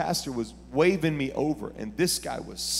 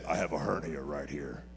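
quoting the man in a much lower voice; lower, slowed voice for the man with the hernia; 11 semitones lower, 13 dB quieter, slower than usual. Retold or imitated. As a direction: drop low and slow to voice the man. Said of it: "He said".

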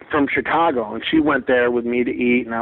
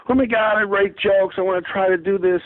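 eager wannabe; 7 semitones higher than usual. Retold or imitated. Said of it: "they go".

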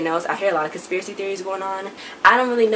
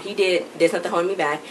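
normal talking voice

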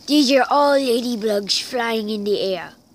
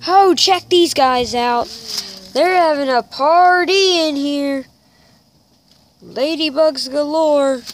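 loud, high excited exclaiming; 4 semitones higher, 8 dB louder than usual. Real, not acted. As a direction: gush over the bug party. Excited about the bugs.

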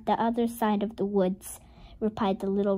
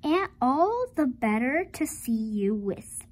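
ordinary delivery, nothing marked

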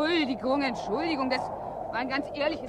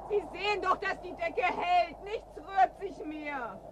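pleading softly; 3 semitones higher, 6 dB quieter, slower than usual. Acted. Pleading quietly while trapped.

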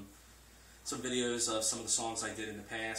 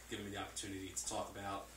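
ordinary talk, nothing marked

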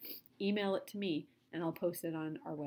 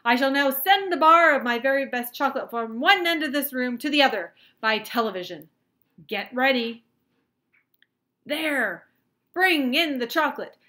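loud showman's announcement, raised pitch; cried out; 5 semitones higher, 14 dB louder than usual. A narrator's reading. Wonka announcing his chocolate demonstration to the room, cried out. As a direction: proclaim it at full volume like a showman. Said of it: "cried Mister Wonka".

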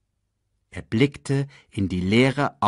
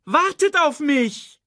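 high, slow, drawn-out shout; wailing call; 5 dB louder, slower than usual. A narrator's reading. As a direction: call after them plaintively. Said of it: "jammerte Sasu".

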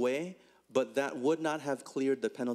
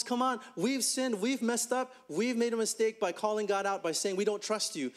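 higher, begging voice; pleading people; 6 semitones higher than usual. Acted. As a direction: plead with Moses for help. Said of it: "The people say to Moses".

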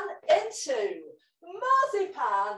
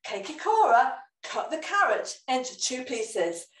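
ordinary delivery, nothing marked

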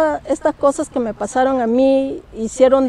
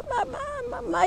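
crying for mom; 12 semitones higher, 12 dB quieter than usual. Retold or imitated. Girl pulled by a ghost crying out for her mother, high and tearful. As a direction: sob out mom in panic.

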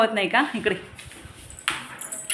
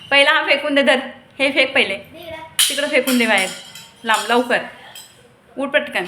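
scolding; 5 semitones higher, 6 dB louder than usual. Real, not acted. Scolding child to throw toys.

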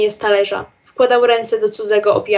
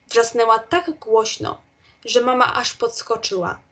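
plain reading voice

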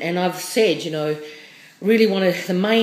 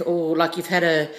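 ordinary delivery

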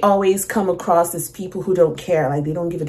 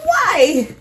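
very high, loud shocked cry; shocked what why; 7 dB louder than usual. Retold or imitated. Retelling her reaction to the singer, a high astonished cry. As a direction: squeal it out in disbelief. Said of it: "I'm like".

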